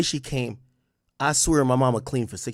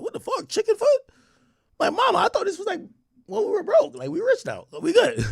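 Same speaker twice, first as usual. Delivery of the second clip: much higher incredulous voice; mock outrage. Son protesting chicken foot to mom, much higher. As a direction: squeak it out in disbelief. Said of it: "I was like".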